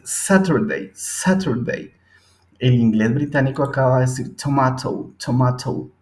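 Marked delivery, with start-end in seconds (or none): 0.0-1.9 British Saturday with a crisp t, sateɾdei, slow and higher; British clear t in Saturday; British accent. Shown as a demonstration. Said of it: "el inglés británico ... va a decir". 4.4-5.9 British tomato, tomato with an explosive t; crisp British t in tomato; British accent. Shown as a demonstration. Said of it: "y es más explosivo".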